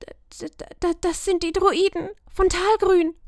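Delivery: stuttering in awe; frightened stammer. A narrator's reading. Lumina stammering as the druids appear. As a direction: stammer at the sight. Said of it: "flüsterte Lumina".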